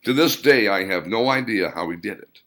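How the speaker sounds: usual speaking voice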